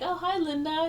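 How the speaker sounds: put-on high, childlike greeting; nephew's high, innocent hello; little kid voice. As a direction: chirp it like a small boy.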